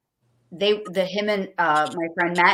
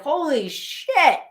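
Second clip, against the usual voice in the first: laughing amazement; 8 semitones higher, 4 dB louder, much slower than usual. Real, not acted. Amazed at the car count.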